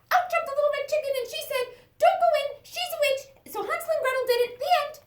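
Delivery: high, fast, loud breathless chick; excited chick. Acted. Chick bursting into the fairy tale to warn Hansel and Gretel. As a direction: blurt it all out in a rush.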